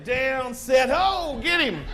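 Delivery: high, loud football yell; field shout. Retold or imitated. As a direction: call it out like a lineman on the snap.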